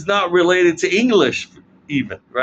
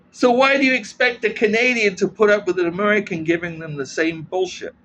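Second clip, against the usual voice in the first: ordinary delivery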